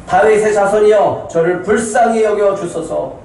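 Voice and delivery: high, loud plea for mercy. Acted. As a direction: shout insistently over those silencing you. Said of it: "더 크게 소리치고 있어".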